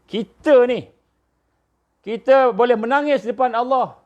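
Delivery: high-pitched urgent sermon delivery; impassioned preaching. Acted. Exhorts the congregation. (khutbah bersemangat, nada tinggi)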